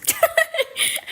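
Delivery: high burst of laughter. Real, not acted. Laughs after burping.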